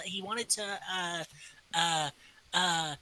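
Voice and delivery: ordinary talk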